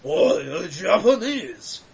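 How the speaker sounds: slow, put-on mock Japanese accent; a lightly done caricature Japanese voice. Shown as a demonstration.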